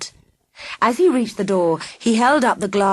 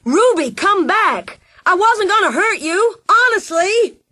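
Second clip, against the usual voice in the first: high, loud, pleading yell; frantic yell chasing the motorbike; 12 semitones higher, 5 dB louder than usual. Acted. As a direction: shout after her as you run.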